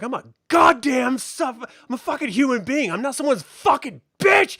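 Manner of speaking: high, loud furious outburst; re-enacted angry shouting, pitch way up; retold yelling. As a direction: yell back at the boss, losing it. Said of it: "yelling at him".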